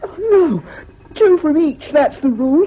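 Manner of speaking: high, loud panicked begging; frightened pleading. Acted. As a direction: plead in terror as the cane comes. Boy begs before caning.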